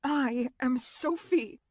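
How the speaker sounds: slow, firm correction; firm insistence; firmly. A narrator's reading. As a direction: set him straight, slowly. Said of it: "I said firmly".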